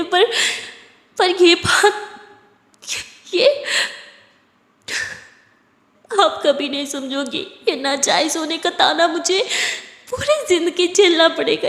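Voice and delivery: tearful outpouring, breaking into sobs; crying. Acted. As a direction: pour out the hurt while crying. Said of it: "तारा सिया को हग करके बहुत रो रही थी".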